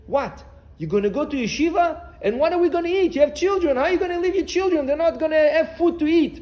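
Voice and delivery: high, loud protest, questions piling up; indignant wife. Retold or imitated. Wife to husband leaving for yeshiva, indignant. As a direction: imitate an alarmed wife berating her husband.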